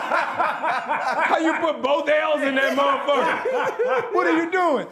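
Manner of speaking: high, drawn-out mock outrage through laughter; laughing incredulity. Actor ribbing co-star over a botched y'all, laughing incredulously. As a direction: demand an explanation while cracking up.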